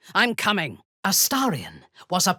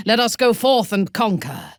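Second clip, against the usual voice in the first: higher, louder battle cry; rallying call; 6 semitones higher, 5 dB louder than usual. Acted. Calling companions forth to conquer, rousing. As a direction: rouse the party to march.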